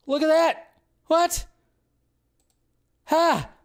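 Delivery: ordinary talk, nothing marked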